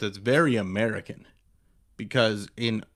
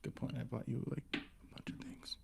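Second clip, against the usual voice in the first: whispering; 19 dB quieter, faster than usual. Real, not acted. Sheepish aside to girlfriend.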